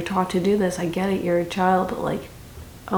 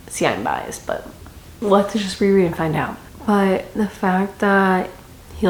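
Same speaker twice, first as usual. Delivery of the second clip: usual speaking voice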